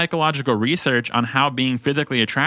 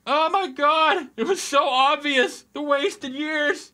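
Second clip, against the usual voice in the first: very high, slow melodramatic lament; mock revelation; slower than usual. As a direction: wail in hammed-up, high-pitched regret. Mock revelation at obvious advice.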